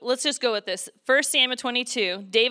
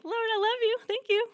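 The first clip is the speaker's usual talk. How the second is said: very high, put-on sweet praying voice; mock grateful prayer; 13 semitones higher than usual. Speaker mocking calm praise while armies chase, in mock-sweet high prayer. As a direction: squeak out a thank-you as a joke. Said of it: "I'm not gonna be like".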